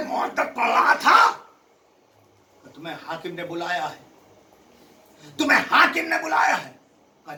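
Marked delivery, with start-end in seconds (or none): 2.7-4.0 lower, softer first take; soft summons. Shown as a demonstration. Repeats summons softly then loudly. 5.4-6.7 higher, louder official command; loud summons. Shown as a demonstration. Repeats summons softly then loudly.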